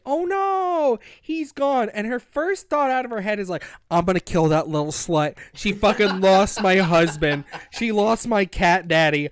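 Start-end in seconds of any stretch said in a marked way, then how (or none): 0.1-1.9 higher and louder, hammed-up panic; mock panic. Heroine finding her husband gone, hammed-up panic. 3.9-9.3 mock fury. Jealous heroine threatening sister.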